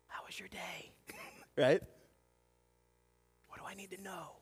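soft unvoiced whisper. Parents whispering after putting the kids to bed. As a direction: whisper so the kids stay asleep. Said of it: "very whispering 'cause you don't wanna wake 'em up".

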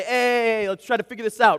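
loud, high call to settle down; shouting over chaos. Retold or imitated. Dad shouting over sisters' fight to make peace, loud. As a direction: raise your voice to break it up. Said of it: "I'm trying to shout over this chaos".

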